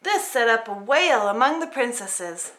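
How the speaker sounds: ordinary delivery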